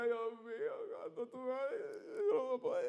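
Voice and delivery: high, tearful, breaking plea to God; retold crying plea. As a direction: sob the words out, begging. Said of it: "yo me miraba en el espejo y yo lloraba". (súplica llorosa a Dios, con la voz quebrada)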